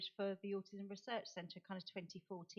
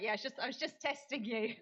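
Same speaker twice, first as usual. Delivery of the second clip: higher, louder embarrassed backpedal with a laugh; laughing her mistake off to the UN staff; sheepish cover-up; 4 semitones higher, 7 dB louder than usual. Retold or imitated. Covering her mistake to the UN staff, sheepish and laughing. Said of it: "So I was like".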